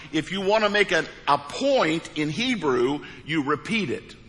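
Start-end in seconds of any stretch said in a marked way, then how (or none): none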